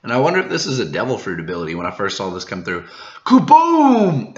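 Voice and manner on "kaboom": high, loud explosive burst; explosion shout. Acted. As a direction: blast the word out like an explosion. Cake bursting from the ground.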